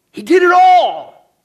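high, drawn-out booming cry; loud climactic proclamation; sudden preaching shout. Acted. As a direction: burst out at full pulpit force. Sudden climax of hushed sermon.

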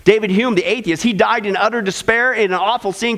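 ordinary delivery

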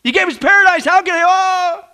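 pleading apology. Pretend. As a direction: beg God, heartfelt and sorry. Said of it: "if they would have said".

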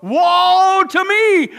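loud, high, drawn-out cry. Acted. Isaiah seeing the Lord, anguished cry. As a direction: wail it out at full force. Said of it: "Isaiah cried".

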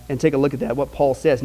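ordinary talk, nothing marked

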